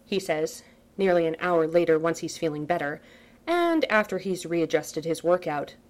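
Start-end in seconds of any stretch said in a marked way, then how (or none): none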